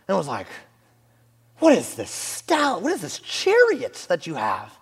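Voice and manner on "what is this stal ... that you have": high, drawn-out mock royal accent; old Persian king. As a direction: play an astonished king of old in a made-up accent. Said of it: "like, an old Persian king showed up".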